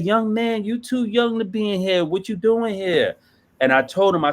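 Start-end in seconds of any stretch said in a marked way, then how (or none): 0.0-0.6 much higher, put-on voice for the old man; old director's voice. Old director greets the young addict, high put-on voice. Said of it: "He said". 0.6-3.2 much higher, put-on voice for the old man; old director's voice. Old director greets the young addict, high put-on voice. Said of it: "He said".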